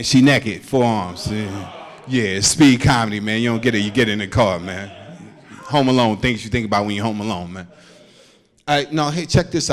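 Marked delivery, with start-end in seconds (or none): none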